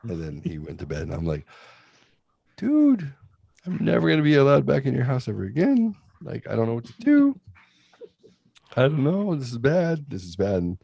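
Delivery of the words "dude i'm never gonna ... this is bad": high, worried rambling; panicked. Retold or imitated. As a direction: fret in a panic. Panicked to friend.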